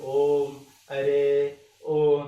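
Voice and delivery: ओम, अरे, ओ each held long and loud; prolated vowels. Shown as a demonstration. Teacher summing up the triple-length vowel, ओम, अरे, ओ drawn out. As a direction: stretch every example to triple length on a steady note. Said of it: "तीन गुना समय लगता है".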